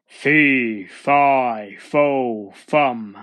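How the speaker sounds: loud, heavy giant chant; storybook giant's booming chant; menacing giant. A narrator's reading. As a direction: drop each word like a stomp. Hungry giant stomps in chanting.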